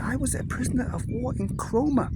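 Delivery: put-on high, happy old-man voice; old German man. Old man telling the English lads of captivity, high happy voice.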